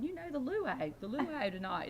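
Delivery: high, emphatic retold insistence; insistent explaining. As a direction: push the word as if it were obvious.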